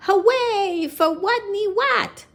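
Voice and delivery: high, loud cheer; cheering cry. Acted. The pupils cheer for Watney, loud. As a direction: cheer for your hero. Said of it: "they cried".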